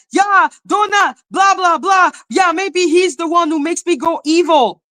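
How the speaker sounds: high, pretend ranting; mock trash talk. Creator pretending to badmouth someone online, mock ranting.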